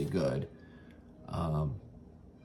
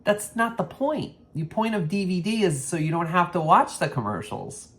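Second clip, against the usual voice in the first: mock-exasperated explaining, higher; 9 semitones higher, 3 dB louder than usual. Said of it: "I'm like".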